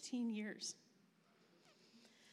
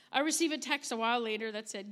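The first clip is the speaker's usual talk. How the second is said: ordinary talk, nothing marked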